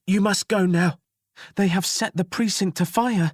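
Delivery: high, loud, breathless urgency; panting jailer. Acted. Jailer warning the children of the fire, breathless. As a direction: pant out the warning, high and pressed. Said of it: "she panted".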